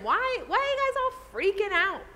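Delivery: high-pitched, put-on puzzled question; unbothered incredulity. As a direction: imitate someone baffled by everyone's panic. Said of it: "It's kind of like the tone".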